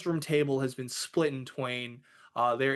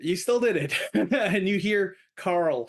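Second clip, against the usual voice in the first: ordinary talk, nothing marked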